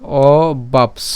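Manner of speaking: "অবাপস" very slowly, o, pause, baps; slow syllable demo. Shown as a demonstration. Teacher stops at the dental s, "অবাপস" very slow as o-baps. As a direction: draw out "অবাপস" and end on a clean ps. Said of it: "দন্তস্ব পর্যন্ত উচ্চারণ করি".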